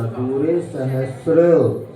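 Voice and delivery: सहस्र slow, with a clean sra; slow model reading of सहस्र; careful pronunciation. Shown as a demonstration. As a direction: model दूरे सहस्र for students. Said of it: "इसी प्रकार स्र बोलना".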